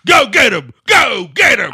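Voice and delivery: bellowed order. Acted. Boss sending attack dog out.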